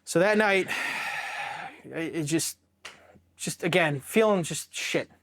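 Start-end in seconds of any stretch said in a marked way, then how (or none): 0.7-1.8 long, heavy exhale; long sigh. Real, not acted. Recalling the night after the blast, long sigh. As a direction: let out a long breath.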